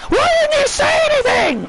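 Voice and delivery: high, furious accusing yell at her tag partners; angry Shotzi. Acted.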